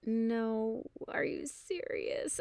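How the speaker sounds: small, high, slow disbelief; heart sinking. Retold or imitated. Customer told the class is overbooked, mildly crushed disbelief. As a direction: let it shrink into soft, drawn-out dismay. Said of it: "felt my heart sink".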